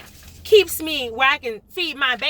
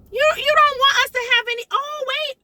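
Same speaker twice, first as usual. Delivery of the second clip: mock realization; 10 semitones higher than usual.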